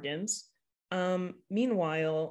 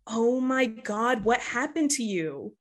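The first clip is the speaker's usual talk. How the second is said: shocked mom, slowed and raised; worried mom; 5 semitones higher, 4 dB louder than usual. Acted. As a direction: gasp it out as a startled mother. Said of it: "And she says".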